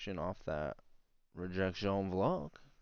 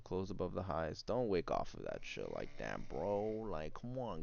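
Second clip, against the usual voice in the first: ordinary talk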